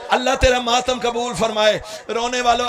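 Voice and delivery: normal talking voice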